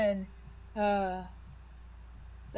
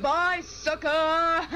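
taunting; 9 semitones higher, 4 dB louder, much slower than usual. Acted. Taunting while leaving.

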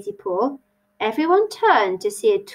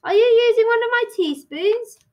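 high-pitched suspicious question; mildly accusing, pitched up; 14 semitones higher, 3 dB louder than usual. A narrator's reading. Hare questions the stranger, suspicious. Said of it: "the Hare said suspiciously".

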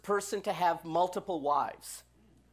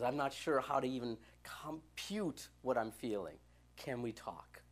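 lightly voiced teen murmuring can we talk; 8 semitones lower, 9 dB quieter than usual. Teen [name] asking the pastor to talk.

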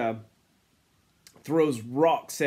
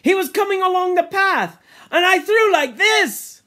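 high, loud, eager shout; attention-seeking; 13 semitones higher, 7 dB louder than usual. Acted. Ralph acting out his throw for attention, excited shout. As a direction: clamour to be watched. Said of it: "He tried for their attention".

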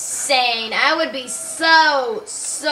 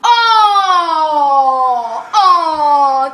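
laughing cry; 4 semitones higher, 8 dB louder, much slower than usual. Real, not acted. Just died.

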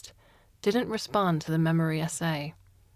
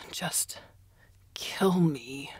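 hopeless whispered plea; much slower than usual. A narrator's reading. Prisoner begging his captor, whispered and hopeless. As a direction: barely breathe the words out.

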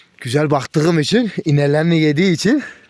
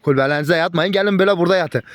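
usual speaking voice

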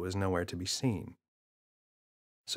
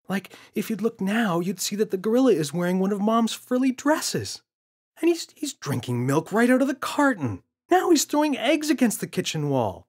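put-on higher, louder kid voice insisting it's real; a frustrated boy pleading to be believed; insistent boy; 12 semitones higher, 6 dB louder than usual. James voiced in story.

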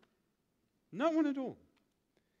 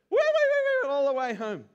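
high, loud squeal of the rhyme's ending; squealing piggy; 8 semitones higher, 9 dB louder than usual. Pretend. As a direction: shriek the wee-wee-wee line. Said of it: "and I would go".

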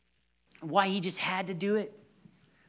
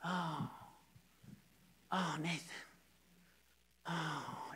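soft, dazed recognition; 13 dB quieter, slower than usual. Retold or imitated. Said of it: "And he would go".

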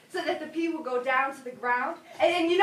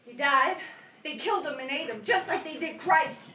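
ordinary talk, nothing marked

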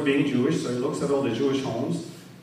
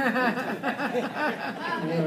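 high breathy laugh at his own joke; 8 semitones higher, 4 dB quieter than usual. Real, not acted. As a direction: chuckle at the punchline.

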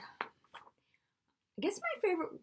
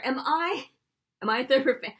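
loud, higher mock indignation; playfully affronted, louder and higher; mock incredulous; 4 semitones higher, 8 dB louder, faster than usual. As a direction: throw the question back in feigned outrage. Mock outrage at being asked.

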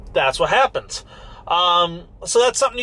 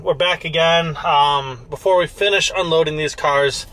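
ordinary delivery

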